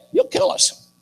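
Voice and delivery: very high, squeaky coward; hammed-up panicky refusal; mock fear. Preacher plays soldiers refusing the giant, mock frightened.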